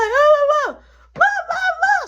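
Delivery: high, loud wordless babble; mock deaf mama yell. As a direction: yell gibberish at him. Deaf mama yelling at him.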